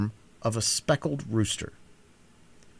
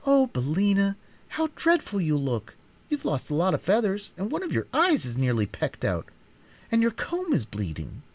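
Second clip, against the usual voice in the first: put-on girl exclaiming over her hurt hen; dismayed exclaim; 9 semitones higher than usual. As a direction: fuss over the wounded hen.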